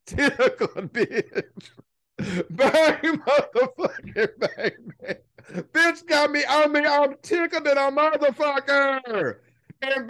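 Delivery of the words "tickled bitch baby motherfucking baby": words bubbling out through real laughter; laughing. Real, not acted. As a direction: laugh through it, slow and tickled. Laughing while tickled.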